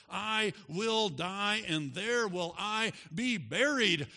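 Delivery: high, loud, building pulpit climax; full-force preaching. Acted. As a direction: build the pledge to a ringing peak. Preaching climax of Ruth's pledge.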